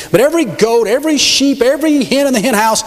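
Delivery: high, emphatic list of orders from God; preacher voicing God's decree; booming divine command. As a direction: proclaim each item like a stern God.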